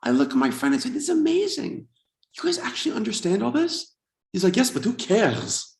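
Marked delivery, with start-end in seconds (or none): 0.9-4.0 high-pitched wonder; amazed excitement. Retold or imitated. Newcomer to Israeli friend at dinner, high-pitched amazement. 4.5-5.8 slow, higher dismissive reply; unimpressed friend. Retold or imitated. Secular Israeli friend answering amazed guest, dismissive.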